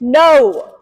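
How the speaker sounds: short, loud, firm no; firm correction. Shown as a demonstration. Trainer showing viewers the correction word, firm. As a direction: say it flat and firm, no anger. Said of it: "Correction word should be firm".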